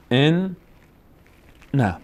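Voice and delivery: "ان" held on the n, pause, then "نه" separately; doubled letter, split. Shown as a demonstration. Teacher shows learners the doubled noon, split in two. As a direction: sound out "ان", stop, then "نه".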